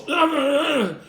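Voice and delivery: high, loud, repeated grunts; imitated groans. The man on the floor grunting at the rescuer, high loud grunts. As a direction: grunt three times like a man disturbed. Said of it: "And he goes".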